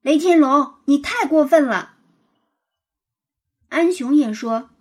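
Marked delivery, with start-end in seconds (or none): 0.0-2.0 louder, higher angry rebuke; angry rebuke of the bully, loud. A narrator's reading. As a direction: call him out in anger. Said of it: "牛牛愤怒地说".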